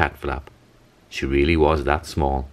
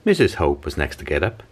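ordinary delivery, nothing marked

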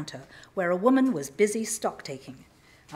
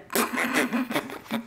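put-on girl's laugh; 3 semitones higher than usual. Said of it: "one of the girls went".

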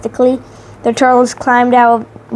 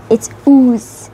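savoring, drawn out; 2 semitones higher, slower than usual. Acted. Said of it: "drawing out the words as though it tasted good".

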